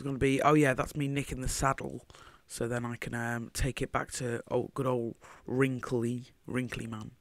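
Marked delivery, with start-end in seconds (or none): none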